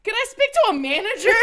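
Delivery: high, loud, laughing outrage; pissed off outburst. Retold or imitated. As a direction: squeal it out, furious but cracking up. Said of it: "I was just pissed".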